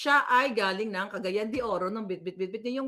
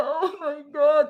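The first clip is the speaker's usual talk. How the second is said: louder, higher, slow fake wail; hammed-up, drawn-out imitation of a victim; mock distress; 4 semitones higher, 6 dB louder, slower than usual. Host mocks woman's overacting, mock distress. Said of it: "Parang akala mo, ginagahasa".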